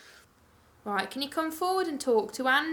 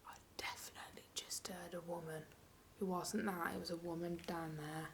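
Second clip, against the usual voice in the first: very soft, lower, half-whispered; hushed report; 5 semitones lower, 17 dB quieter than usual. Real, not acted. Ghost hunter tells partner of a woman heard, hushed. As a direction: keep it right down, nearly whispering.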